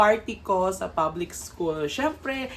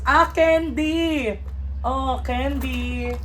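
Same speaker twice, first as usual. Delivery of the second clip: delighted; 6 semitones higher, 5 dB louder, much slower than usual. Real, not acted. Finds candy in gift.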